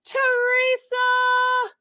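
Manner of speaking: high, stretched-out name call; drawn-out calling. Real, not acted. Host greeting a viewer in the comments, drawn-out high call. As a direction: sing the name out high and slow.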